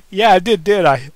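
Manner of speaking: loud, smug and amused; smug chuckle. Acted. Kane gloating to Daniel, smug chuckling. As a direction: gloat with a grin in the voice. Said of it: "He chuckled with a smug grin".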